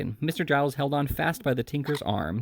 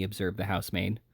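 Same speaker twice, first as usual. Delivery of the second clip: usual speaking voice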